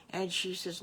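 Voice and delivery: ordinary delivery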